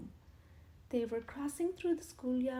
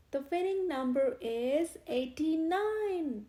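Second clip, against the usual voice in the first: slow, loud announcing call to the crowd; vicar calling out; 5 semitones higher, 7 dB louder, slower than usual. A narrator's reading. Vicar announces the raffle winner to the crowd, calling out. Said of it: "the vicar called out".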